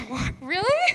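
high-pitched, incredulous question; retold surprise. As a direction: ask it high, as if amazed. Retold amazement at Lee's answer.

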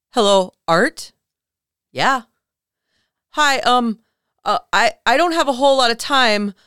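frantic caller. Retold or imitated. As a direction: play the scared caller begging for time.

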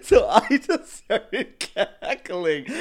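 loud, high laughing speech. Real, not acted. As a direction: talk while laughing hard. Laughing while telling story.

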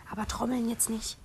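whispering. Real, not acted. Hushed aside to child.

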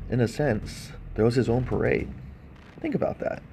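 normal talking voice

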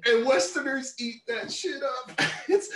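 mock them with a high squeal; high, slow teasing jab. Real, not acted. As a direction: pitch jumps way up, drawn out. Mocking Western fans.

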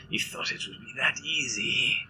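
lightly voiced gloating monster; mocking creature voice. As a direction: sneer it as the hidden monster. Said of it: "a voice in your ear that says".

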